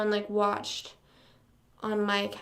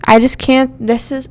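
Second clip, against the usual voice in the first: ordinary talk, nothing marked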